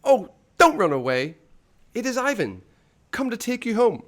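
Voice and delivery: high, loud pleading shout; pleading call. Acted. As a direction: beg him to stay, calling after him. Ivan pleads with fleeing figure.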